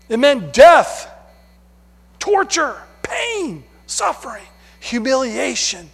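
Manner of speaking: impassioned. Acted. Listing horrors of the cross.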